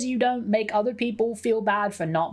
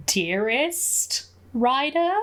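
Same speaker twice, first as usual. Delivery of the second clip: slow, rising question on each word; flustered, questioning echo, higher and slower; taken aback; 5 semitones higher, much slower than usual. Real, not acted. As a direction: draw it out, pitch climbing in disbelief. Repeats greeting, taken aback.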